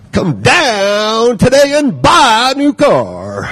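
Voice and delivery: slow, high car-ad drawl; drawn out vowels. Shown as a demonstration. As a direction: stretch every vowel like an ad. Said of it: "you stretch your vowels out".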